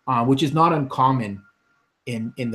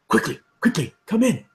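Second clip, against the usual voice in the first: hurried wizard; 9 semitones higher, 3 dB louder than usual. Acted. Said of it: "He goes".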